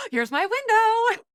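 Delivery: high, drawn-out thrilled squeal; excited realization. Retold or imitated. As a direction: gasp it out like a sudden big chance. Acting out her excited realization.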